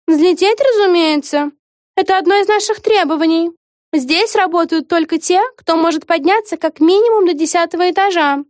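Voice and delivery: high, condescending answer; haughty witch. A narrator's reading. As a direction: explain it as obvious. (высокомерная ведьма)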